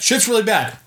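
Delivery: high-pitched urgent announcement; acted panic, played for laughs; mock alarm. Netflix voiced raising the alarm.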